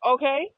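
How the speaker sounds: OKAY loud, with a high sharp rise; final emphatic okay. Shown as a demonstration. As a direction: end the matter with a punched, lifted OKAY. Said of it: "that's the end of it".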